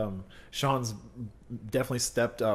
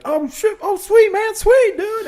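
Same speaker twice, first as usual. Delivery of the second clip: mock excited shout, much higher and louder; hyped shout; 11 dB louder, slower than usual. Retelling a stranger's shout over his tattoos, hammed-up. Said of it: "he was like".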